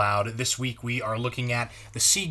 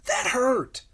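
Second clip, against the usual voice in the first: exclamation; 10 semitones higher, 5 dB louder than usual. A narrator's reading. Narrator exclaims about shots.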